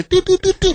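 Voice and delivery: high, sing-song electronic beeps; imitated door lock beeps. Caller mimics the door lock's high electronic beeps. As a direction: mimic the keypad chirping back at you. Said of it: "all you hear".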